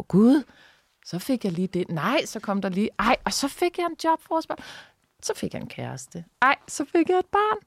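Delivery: high-pitched, gushing amazement; hammed-up wonder, voice leaping high; mock delighted surprise. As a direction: act out naive delight at each lucky break. Acts out own delighted self-talk.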